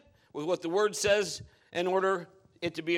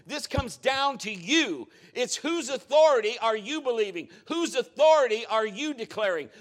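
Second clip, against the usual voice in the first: preaching at full force; 3 semitones higher, 5 dB louder than usual. Real, not acted. Preaching builds.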